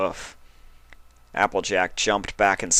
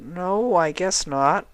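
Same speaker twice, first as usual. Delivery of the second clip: a little defeated; 11 semitones higher, slower than usual. A narrator's reading. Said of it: "a little defeated".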